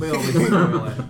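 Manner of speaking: laughing through the words; giggly, teasing repeat. Real, not acted.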